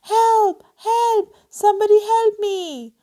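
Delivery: high, loud desperate cries; trapped girl yelling for help; shouting for help. Acted. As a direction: scream for help from the trap. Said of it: "heard Amy shouting".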